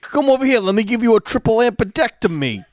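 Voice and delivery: hammed-up tough-guy comic; Andrew Dice Clay. Doctor offering surgery, mock New York tough-guy voice. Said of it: "I love Andrew Dice Moore".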